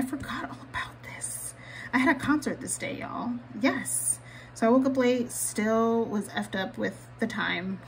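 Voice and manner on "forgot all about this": whispered, unvoiced, to herself; whisper aside. Real, not acted. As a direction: breathe it out as an aside. Aside to viewers.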